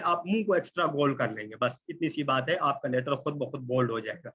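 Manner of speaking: ordinary talk, nothing marked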